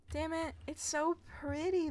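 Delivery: normal talking voice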